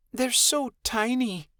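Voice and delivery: slow, high, awed softness. Acted. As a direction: marvel at the tiny pups. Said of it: "Midnight Star awed".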